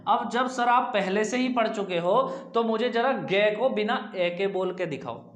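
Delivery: normal talking voice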